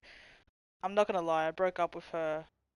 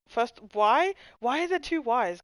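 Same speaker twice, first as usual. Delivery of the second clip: louder, higher baffled outburst; louder, higher incredulous why; exasperated why; 5 semitones higher, 9 dB louder than usual. Real, not acted. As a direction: demand an answer, fed up. Baffled by a spelling.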